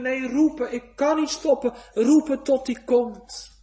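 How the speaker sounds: loud, high insistent cry; refusing to stop. Acted. Sinner answers those hushing him with a loud, high insistent cry. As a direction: shout it, you cannot stop calling.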